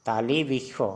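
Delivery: तालिबिखो with a clear kh where सो is written; kh not s. Shown as a demonstration. Teacher shows learners the Assamese kh sound. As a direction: say तालिबिखो, khо at the end. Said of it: "ऐसे तो तालिबिसो होता है, लेकिन उच्चारण होगा तालिबिखो".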